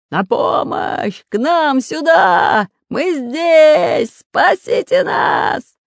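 slow, high, long-drawn shouts; calling for help. Acted. Pirates hail the balloon for rescue, shouting. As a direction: holler desperately into the distance. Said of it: "закричал громовым голосом Буль-Буль".

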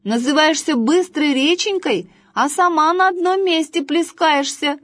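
put-on high, teasing boy voice; chatty boy. A narrator's reading. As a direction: tease the river. Boy teases river.

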